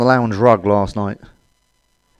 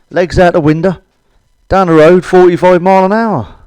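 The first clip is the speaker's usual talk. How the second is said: normal talking voice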